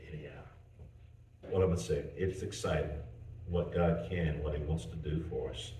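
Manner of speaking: ordinary talk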